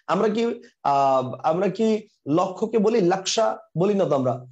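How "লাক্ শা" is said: "লাক্সা" said lak-sha, with k plus sh; Hindi pronunciation. Shown as a demonstration. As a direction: give "লাক্সা" the Hindi k-sh sound. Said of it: "এটা হিন্দি উচ্চারণ".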